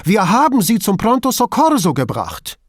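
high, loud insistence; agitated self-defence; protesting. A narrator's reading. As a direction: protest your innocence, pitch jumping up. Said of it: "protestierte Duso".

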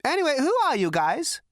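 very high, loud squeak; squeaky spaced-out question. Acted. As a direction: pipe up like a giddy loon. Prak asks the visitors.

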